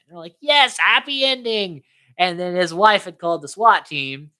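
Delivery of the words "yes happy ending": high, drawn-out celebration; mock cheer. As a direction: cheer the good news slowly. Employees cheering a restored bonus.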